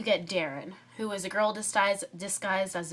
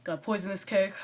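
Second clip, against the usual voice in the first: lightly lowered boyish voice, hammed-up; mock dude voice. Shown as a demonstration. Drama student demoing her boy disguise, lightly mock dude voice. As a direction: play a girl faking a boy's voice. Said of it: "I have to talk like this".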